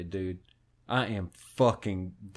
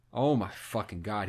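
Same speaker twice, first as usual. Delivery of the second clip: usual speaking voice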